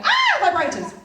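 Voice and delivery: high, loud, giddy squeal; excited squeal. Retold or imitated. Her naughty inner voice spotting the toys, giddy squeal. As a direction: burst out giddy like a kid in a sweet shop. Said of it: "the other part of my brain is going".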